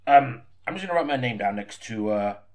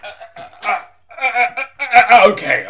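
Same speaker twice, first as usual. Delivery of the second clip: stammering, rising and getting louder; flustered dithering; 10 semitones higher, 13 dB louder than usual. Acted. Fumbling before the recording starts, rising panic. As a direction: build the panic into a loud okay.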